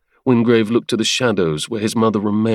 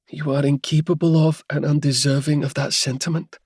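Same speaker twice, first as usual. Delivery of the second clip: higher woman's voice, calm and cutting; a cool, higher-voiced rebuke; calm Helia; 6 semitones higher than usual. Helia coolly condemns the Duke in a calm, higher woman's voice. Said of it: "The quiet calm in Helia's voice".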